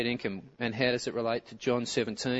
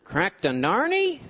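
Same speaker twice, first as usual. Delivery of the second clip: baffled; 8 semitones higher, 6 dB louder, slower than usual. Acted. Mimicking baffled Americans.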